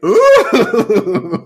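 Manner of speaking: high, loud, laughing ooh; a gleeful mock-impressed ooh; squealed ooh through a laugh; teasing ooh. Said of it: "I'm like".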